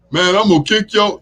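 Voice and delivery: high, loud mock drunk threat; fight-ready drunk. Imitating a drunk who wants to fight, mock threat. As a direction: bark it like a drunk picking a fight. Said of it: "wanna fight type drunks".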